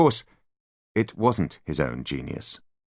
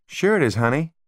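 higher, sugary adult voice; indulgent guide; 8 semitones higher than usual. A narrator's reading. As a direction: humor a child sweetly. Guide talking down to child.

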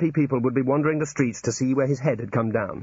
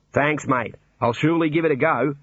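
Australian warder; 4 semitones higher than usual. Acted. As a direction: play the cheery Australian warder.

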